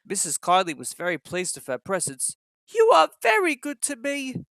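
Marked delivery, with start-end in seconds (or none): 2.7-4.4 much higher, louder lightly voiced old lady; grateful old lady. A narrator's reading. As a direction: thank them like a frail invalid.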